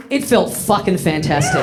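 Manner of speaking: ordinary delivery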